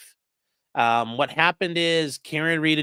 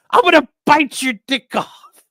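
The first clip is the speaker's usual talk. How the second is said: much higher and louder, a wild mock snarl; mock Karen threat; 12 semitones higher, 8 dB louder than usual. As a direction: ham up her unhinged threat. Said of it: "She's like".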